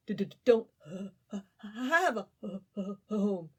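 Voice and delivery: stuttering through chattering teeth; freezing, barely able to talk. Acted. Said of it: "his teeth chattering".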